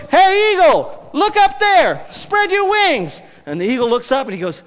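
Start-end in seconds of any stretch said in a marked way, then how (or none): 0.1-3.2 high, loud call up to the sky, last words slow. Acted. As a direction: shout up at the eagle to spread its wings. Native man calls to falling eagle.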